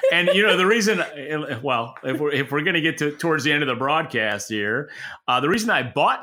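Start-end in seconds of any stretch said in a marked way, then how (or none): 0.1-1.0 talking through a real laugh, louder and higher. Real, not acted. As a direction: laugh the words out after the joke. Laughing after the Netflix joke.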